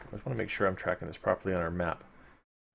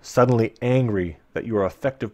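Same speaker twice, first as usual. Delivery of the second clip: story narration; 6 dB louder than usual. Acted. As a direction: paint the dank cavern for listeners. Reading gamebook narration.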